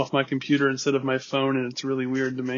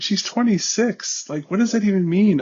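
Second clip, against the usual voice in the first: incredulous; 7 semitones higher, 3 dB louder than usual. Real, not acted. Incredulous at the character's claim.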